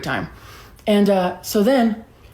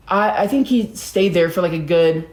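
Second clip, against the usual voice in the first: plain reading voice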